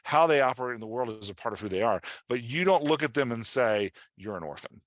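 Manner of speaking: normal talking voice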